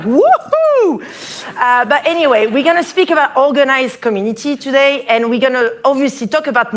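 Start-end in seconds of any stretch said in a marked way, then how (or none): 0.0-1.0 a much higher, louder whoop; hammed-up woohoo. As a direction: whoop it up like a cheerleader. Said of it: "I thought".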